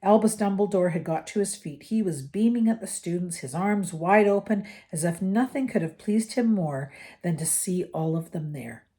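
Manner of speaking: usual speaking voice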